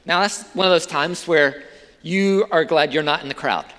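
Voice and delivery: ordinary talk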